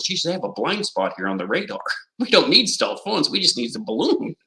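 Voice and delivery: lightly acted gleeful schemer, high-pitched; amused mock scheming, laughing between phrases; imagined foreign strategist. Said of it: "if you were the Chinese or the Russians".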